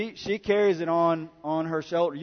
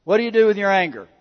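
ordinary talk

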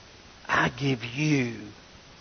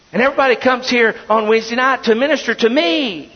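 louder, higher mock boasting; mock self-importance; 6 semitones higher, 4 dB louder than usual. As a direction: puff yourself up as the star pastor. Mocking self-centred pastor.

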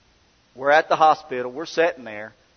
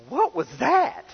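baffled; 12 semitones higher, slower than usual. Retold or imitated. Wife baffled after the prayer.

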